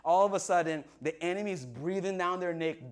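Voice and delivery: usual speaking voice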